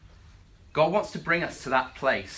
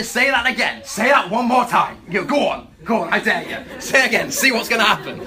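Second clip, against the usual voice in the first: louder, daring parent challenge; mock threat; 5 semitones higher, 5 dB louder than usual. A parent dares the child to say it again, mock menacing. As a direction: dare them to repeat it, half joking.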